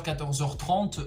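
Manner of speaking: ordinary talk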